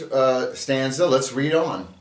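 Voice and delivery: ordinary talk, nothing marked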